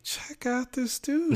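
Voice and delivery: high, slow mock whisper-aside; slow, high amused mock aside. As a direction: mimic himself nudging a friend. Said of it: "and I was like".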